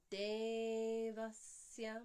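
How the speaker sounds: Devasya chanted with a long held first E; two-count E. Shown as a demonstration. Showing the correct vowel counts, Devasya chanted long. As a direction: stretch DE in Devasya, keep va short. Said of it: "E is a two count vowel".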